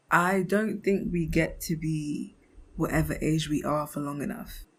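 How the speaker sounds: normal talking voice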